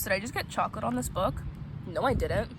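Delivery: ordinary talk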